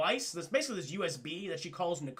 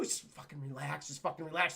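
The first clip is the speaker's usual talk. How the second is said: low, softer, exasperated calming; tense hushing; 5 semitones lower, 5 dB quieter than usual. Retold or imitated. Cat friend to panicking Antoine, low exasperated hush. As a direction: shush someone who is panicking.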